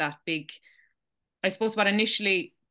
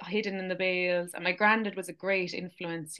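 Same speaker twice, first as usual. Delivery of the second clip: ordinary talk, nothing marked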